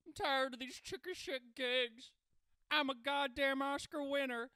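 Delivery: loud, high, indignant diva impersonation. Acted. Improv player becoming a fed-up diva, hammed-up and loud. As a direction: declaim like a fed-up star. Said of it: "a [name] comes out".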